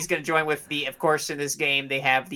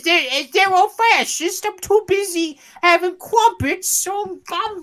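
mock British accent; 14 semitones higher than usual. Mocking the British teatime, high mock British accent.

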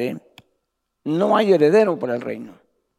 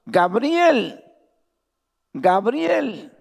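calling out; 6 semitones higher, much slower than usual. Acted. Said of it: "que gritaba y decía".

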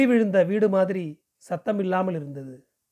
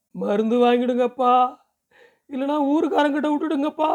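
desperate plea; 7 semitones higher than usual. A narrator's reading. Daughter begging her father for poison, high desperate plea. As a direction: plead upward, stretching the final appeal.

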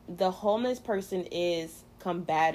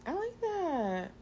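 high, drawn-out coo of approval; delighted approval; 11 semitones higher, 4 dB quieter, slower than usual. Real, not acted. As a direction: stretch it out high in real approval. Approving her friend's answer.

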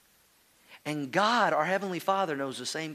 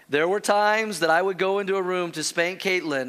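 usual speaking voice

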